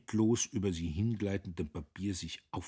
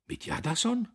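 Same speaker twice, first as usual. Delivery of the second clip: high, louder anxious question; 5 dB louder than usual. A narrator's reading. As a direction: ask in alarm, pitch jumping up. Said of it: "forschte er angstvoll".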